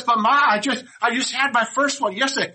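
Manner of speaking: put-on high, loud pleading little boy; protesting boy. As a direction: whine back at Ma as the boy. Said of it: "He goes".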